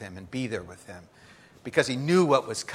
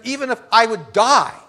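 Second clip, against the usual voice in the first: louder and higher, swearing loyalty; a loud proud promise, raised pitch; Peter's loud vow; 4 semitones higher, 9 dB louder than usual. Acted. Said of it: "He says to him".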